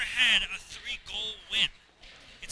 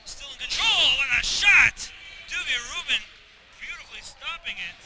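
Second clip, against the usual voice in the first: loud excited goal call; excited shout; 4 semitones higher, 10 dB louder than usual. Real, not acted. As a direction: yell it out like a big save. Play-by-play excitement.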